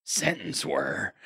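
lightly voiced gruff Snake. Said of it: "Snake will respond and go".

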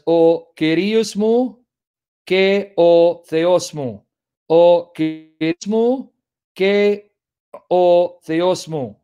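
slow "O Kyrios mu ke o Theos mu" with Greek vowels; Greek pronunciation. Shown as a demonstration. Reading John's Greek to students in careful Greek pronunciation. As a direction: draw out "Kyrios" the Greek way, word by word. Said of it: "Trying to pronounce like Greeks would".

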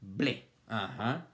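ordinary delivery

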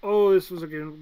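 usual speaking voice

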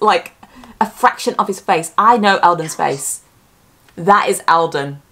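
ordinary talk

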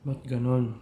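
talking with food in mouth; eating pastry while speaking; chewing while talking. Real, not acted. Seen 3: Bitten tart in hand; lips close between words, chewing likely.

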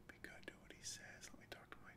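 fast whisper rising at the end; whispered plea. Acted. Mother pleading to her daughter in a fast whisper. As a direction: hush it like someone might hear. Voicing a hushed captive plea.

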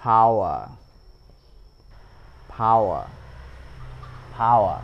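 "our" and "power" with a clear a-u glide; model our and power. Shown as a demonstration. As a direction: repeat "power" slowly as a model word. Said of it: "giống như từ này". (đọc mẫu "power", lướt rõ a sang u)

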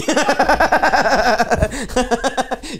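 long rising high-pitched laugh. Real, not acted. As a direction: crack up at your own joke. Teasing friend, cracking up.

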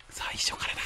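breathless whisper; whispered despair. Real, not acted. 最初からやり直しと知りささやく. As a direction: barely breathe the words.

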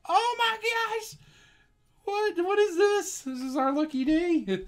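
very high, drawn-out squeal; astonished delight. Real, not acted. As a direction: squeal in joyful disbelief. Guessed right again, astonished.

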